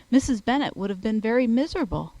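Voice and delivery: ordinary talk, nothing marked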